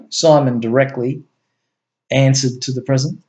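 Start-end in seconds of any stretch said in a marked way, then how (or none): none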